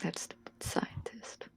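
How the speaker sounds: bitter whispered grumble. Acted. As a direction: hiss it under your breath, slowly. Muttering resentfully in character.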